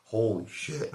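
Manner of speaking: mock stunned exclamation after a fight; retold shock. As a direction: blurt it out, stunned by what you did. After pounding his head into ground.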